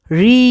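"রি" with a long rising vowel; model pronunciation. Shown as a demonstration. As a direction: sound out "রি" clearly, drawn out. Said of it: "চলো আরেকবার বলি". ("রি" টেনে স্পষ্ট উচ্চারণে)

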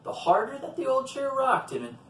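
usual speaking voice

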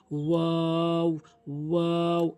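ওয়াও stretched long and slow; rounded mouth. Shown as a demonstration. Teacher demonstrates the letter, long rounded ওয়াও. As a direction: round the lips and hold ওয়াও. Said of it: "মুখটি গোলাকার করে".

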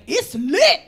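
loud, excited cheer; partying rats. Pretend. Rats celebrating when the train went dark, excited cheer. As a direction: whoop it like a rowdy reveler.

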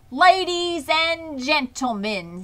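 grand loudspeaker voice; fair announcer. A narrator's reading. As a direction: boom it out to the whole fairground.

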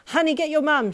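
high, loud mother's yell; urgent yell. A narrator's reading. Panicked mum racing to her daughter, urgent yell. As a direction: yell it out in a hurry. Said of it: "she yelled".

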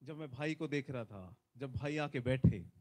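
ordinary talk

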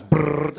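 loud buzzing lip trill; rumble strip noise. Acted. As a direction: imitate tyres on a rumble strip. Rumble strip wakes driver.

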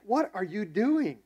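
appalled protest, retold; incredulous. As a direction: stretch it out in loud, exasperated disbelief. Retelling shocked reaction to pastor.

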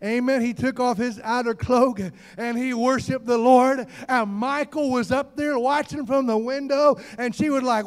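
ordinary talk

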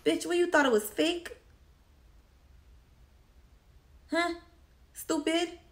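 high, scornful put-down; scornful retort. Real, not acted. To the boyfriend, a high scornful jab. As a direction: jeer at his excuse. Her reply to his excuse.

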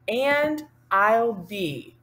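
very slow, spaced words; slow spoken demo. Shown as a demonstration. Teacher pacing words to hand signs, slow speech. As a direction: say each word separately, unsung.

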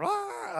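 high pitched imitated wail of a little girl; playful imitation of a daughter's howl; mock child cry. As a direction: do a short hammed-up kid's cry. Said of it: "and so she's crying".

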